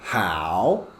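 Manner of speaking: very slow, sing-song how are you; sing-song drill. Shown as a demonstration. Teacher drilling the class, slow sing-song. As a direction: draw out you in a singing drill. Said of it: "this one should be".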